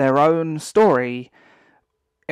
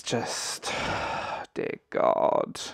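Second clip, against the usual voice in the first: exasperated whisper; 4 semitones lower, 7 dB quieter, much slower than usual. Real, not acted. Exasperated sigh at show excuses.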